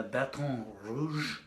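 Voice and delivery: slow put-on French accent on Baton Rouge; French-style accent. Shown as a demonstration. Poet helping the audience know the city, exaggerated French accent. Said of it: "when I say it like that".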